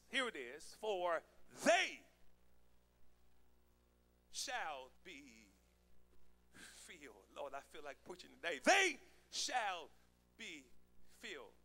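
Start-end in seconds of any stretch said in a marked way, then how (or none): 0.8-7.3 emphatic "they", long pauses after; stress on they. Shown as a demonstration. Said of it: "the emphasis is on they". 8.6-11.5 emphatic "They", each following part set apart; stress on They. Shown as a demonstration. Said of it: "the emphasis is on they".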